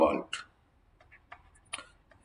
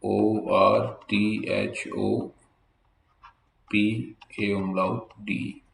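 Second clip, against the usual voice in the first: slow spelling; much slower than usual. Shown as a demonstration. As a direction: spell each letter with a pause. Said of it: "जो spelling बोल रहा हूँ". (धीरे-धीरे अक्षर बोलना)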